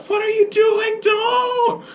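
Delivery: very high, squawked shout; imitating a boy's high-pitched shout; mock protesting yell. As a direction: yell it like an outraged kid. Acts out Elliot yelling at sister.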